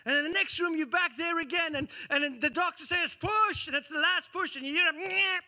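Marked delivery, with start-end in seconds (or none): 3.2-3.7 doctor calling the last push in the delivery room; urging push. Acted. 4.9-5.4 high mock newborn wail; playful imitation of a newborn crying; imitating baby cry. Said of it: "the little baby crying".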